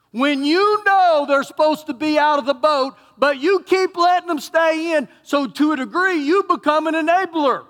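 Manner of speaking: loud, high preaching climax; fervent pulpit cry; preaching at full force. Real, not acted. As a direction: drive the warning home to the congregation.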